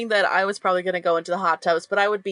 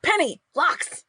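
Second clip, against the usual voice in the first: higher, searching call to friends; calling for companions; 5 semitones higher than usual. Acted. Turning round to find missing friends, searching call. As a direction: call out names uncertainly, looking round. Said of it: "I'm calling for you".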